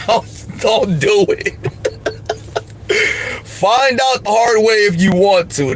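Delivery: giggling through the warning; laughing warning. Real, not acted. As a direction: chuckle while you caution them. Laughing warning about riding.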